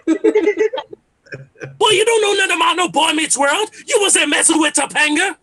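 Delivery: high, loud, badgering challenge; obnoxious old head. Comedian as future old head defending his cartoons, loudly obnoxious. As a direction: mimic your dad's old-head hollering. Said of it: "I'm gonna be an old head too".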